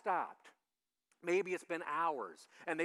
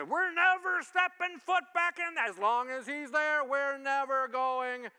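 loud, high mock outrage; hurt churchgoers refusing to come back, loudly; indignant members; 7 semitones higher, 7 dB louder, slower than usual. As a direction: vow never to return, fuming. Offended members storming out.

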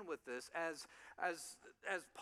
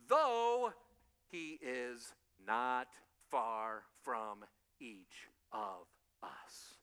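slow earnest emphasis; 8 semitones lower, much slower than usual. Acted. Closing point of sermon.

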